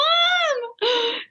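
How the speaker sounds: sharp high gasp; frightened gasp. Retold or imitated. Calling mom over itchy nipples, frightened gasp. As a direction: gasp as if scared. Recalling scared call for mom.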